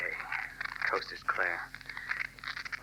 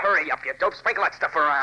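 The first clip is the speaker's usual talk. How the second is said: louder, higher, rushed order; urgent; 7 semitones higher, 6 dB louder than usual. Acted. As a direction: push him to hurry up. Arson in a hurry.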